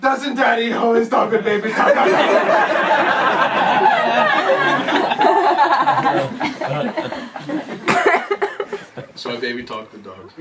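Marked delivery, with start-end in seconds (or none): 0.0-2.0 put-on higher, louder baby voice, laughing; mock baby talk from Uncle Adam. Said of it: "he goes". 2.0-9.1 long high laughter; can't stop laughing. Real, not acted. Laughing at own story.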